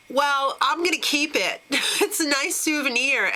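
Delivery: a hint of cheeky smugness, keeping the prize; mock Colton smug. Recapper voicing contestant keeping idol, lightly mock smug. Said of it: "And he's like".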